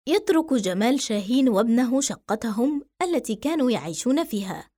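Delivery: ordinary delivery, nothing marked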